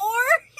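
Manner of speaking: short high burst of a laugh; high squeal of a laugh at the story. Real, not acted. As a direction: let a high giggle out.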